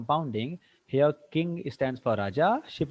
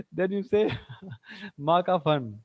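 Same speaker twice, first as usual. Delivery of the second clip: chuckling through the joke; laughing; 6 semitones higher, much faster than usual. Real, not acted. Teacher laughing while joking to class about a silly meaning.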